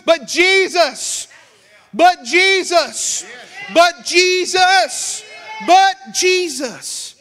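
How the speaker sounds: slow, loud, high repeated cry; preaching climax. Real, not acted. Preacher crying out to teenagers, slow and loud. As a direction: stretch each "but Jesus" out at full force.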